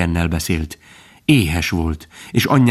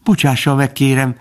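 modest apology, pitched higher; szerényen; 6 semitones higher than usual. A narrator's reading. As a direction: apologise humbly as a young fawn. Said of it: "mondta Bambi szerényen". (szerény, magas hangú bocsánatkérés)

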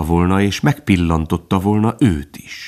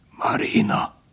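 soft, effortful whisper; straining, weak; 5 dB quieter, slower than usual. A narrator's reading. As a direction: whisper the name with failing strength. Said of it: "mondta erőlködve". (erőlködve, elhalón suttogva)

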